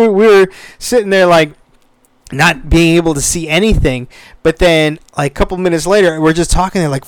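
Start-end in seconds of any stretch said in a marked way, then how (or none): none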